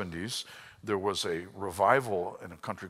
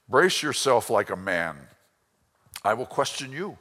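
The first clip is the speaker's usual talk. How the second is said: booming God; 7 dB louder than usual. Acted. Voicing God to Job.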